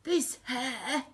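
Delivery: lightly voiced high villain; a hint of a complaining thief; Hugh the crook. A narrator's reading. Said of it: "said Hugh".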